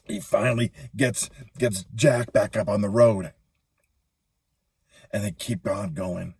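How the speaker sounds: ordinary delivery, nothing marked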